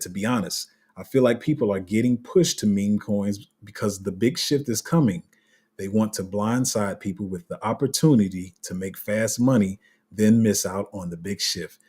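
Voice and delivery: measured read, each word given room. Shown as a demonstration. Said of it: "I'ma say it slower".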